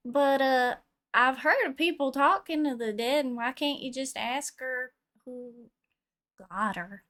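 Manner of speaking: ordinary talk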